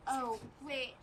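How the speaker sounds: ordinary talk, nothing marked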